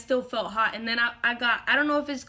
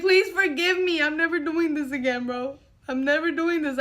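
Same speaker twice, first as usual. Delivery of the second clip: begging, crying; 3 semitones higher than usual. Retold or imitated. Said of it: "I was like".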